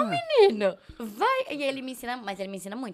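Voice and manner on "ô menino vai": much higher, loud exclamation; hammed-up high-pitched ô menino vai; mock exasperated call. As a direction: throw up her hands at the cheeky kid. Retells her reply to the boy.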